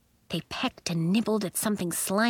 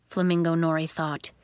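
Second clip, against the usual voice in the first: ordinary delivery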